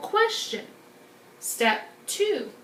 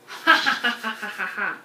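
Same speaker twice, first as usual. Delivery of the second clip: evil scientist laugh; 2 semitones lower, faster than usual. Acted. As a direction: cackle like a mad scientist. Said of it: "your best evil scientist laugh".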